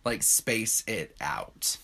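slow, soft, measured caution; drawn-out, hushed advice; friends' warning. Retold or imitated. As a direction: retell a friend's warning slowly and quietly. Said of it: "they're just like".

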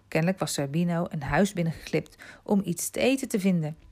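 usual speaking voice

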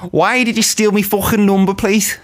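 lightly done Scouse demand, swearing; Scouse accent. Acted. Asking a friend for the number plate, mock Scouse accent. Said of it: "I'm in character for Scouse".

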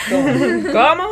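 mock the sing-song coastal accent; sing-song imitation. Imitating the friend's sing-song way of talking, laughing. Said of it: "habla muy cantadito".

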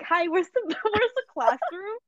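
high, slow, sheepish question to a stranger; awkward question. Pretend. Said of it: "was like".

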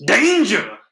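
high, loud warning shout; [name] impression. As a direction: bark the sign's warning. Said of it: "I like that you went with the [name] impression".

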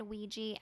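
Ouija said as WEE-jee; wee-jee pronunciation. Shown as a demonstration. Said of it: "I always said".

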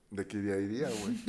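tipsy. Real, not acted. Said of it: "Estoy pedo".

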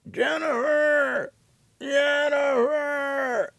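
high, slow, drawn-out wailing call; old man calling. Retold or imitated. Grandfather calling his granddaughter Jennifer from inside, high wailing shout. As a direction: stretch the name out like a frail old man crying for help.

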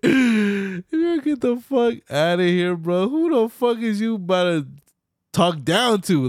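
high, heated pretend comeback; mock furious. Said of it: "I'd be like".

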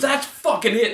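high, mock-furious outburst; fed up. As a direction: retell the moment he loses his temper. Stranger snaps in retold quarrel.

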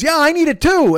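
high, loud call from another stall; other guy yelling. Retold or imitated. Another trapped guy calling out loudly from his stall. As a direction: shout out you need paper too. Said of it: "the other guy goes".

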